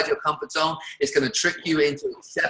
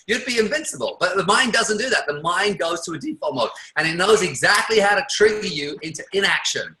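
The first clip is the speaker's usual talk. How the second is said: ordinary delivery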